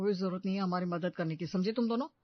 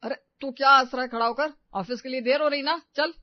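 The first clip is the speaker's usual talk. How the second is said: sharp loud scold, pitched up; 5 semitones higher, 8 dB louder than usual. Acted. Brother scolds laughing sibling, loud and sharp. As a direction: snap at him to get moving.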